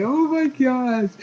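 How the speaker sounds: high, drawn-out squeal of amazement; hammed-up fan delight. Fan seeing an old running joke return, hammed-up delight. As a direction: squeal it slowly, imitating a thrilled fan.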